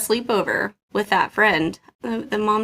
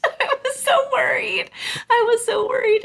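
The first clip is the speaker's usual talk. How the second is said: high worried; 13 semitones higher than usual. Real, not acted. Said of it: "repeated comment".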